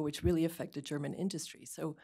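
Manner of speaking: ordinary delivery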